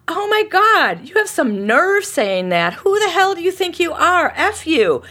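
high, loud outraged tirade; furious venting at an imagined person; yelling. Shown as a demonstration. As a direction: let them have it, all out. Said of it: "I'm just yelling at this imagined person".

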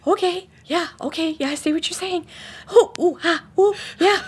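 high, whimpering mock fright; exaggerated frightened little voice, near tears; acted cowering, shivering. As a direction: agree timidly as if trembling. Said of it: "cowering and shivering and going".

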